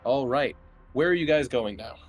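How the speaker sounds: normal talking voice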